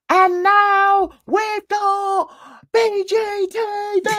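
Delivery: high, slow, singled-out syllables; hammed-up grand guest reveal; mock announcer. Mimicking a streamer presenting the next guest, showy high announcement. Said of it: "But then she said".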